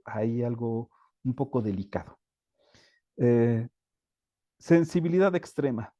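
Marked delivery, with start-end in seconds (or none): none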